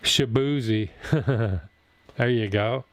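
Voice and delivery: usual speaking voice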